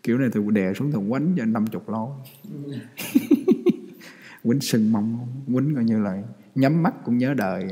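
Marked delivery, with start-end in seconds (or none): 2.2-4.1 amused laugh. Real, not acted. Laughs at his own joke.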